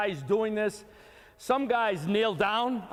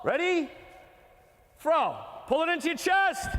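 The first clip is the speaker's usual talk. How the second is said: yelling from the sidelines; 7 semitones higher than usual. Real, not acted. Coach calling drill commands.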